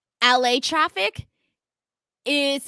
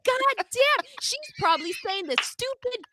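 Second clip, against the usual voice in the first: higher, self-mocking grumble; embarrassed exasperation; 8 semitones higher than usual. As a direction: roll your eyes at yourself. Embarrassed at hotel desk.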